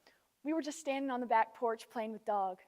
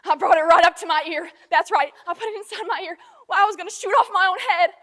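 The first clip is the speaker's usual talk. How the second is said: high, very loud shouting; distraught, building full-voiced cry; anguished outburst; 6 semitones higher, 16 dB louder than usual. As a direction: shout the confession in desperation. Confesses near suicide in monologue.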